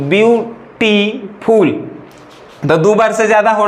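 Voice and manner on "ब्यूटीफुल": ब्यूटीफुल drawn out slowly, each of its three syllables sounded apart; slow syllable count on ब्यूटीफुल; split into syllables. Shown as a demonstration. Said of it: "तीन बार लग रहा है कि नहीं".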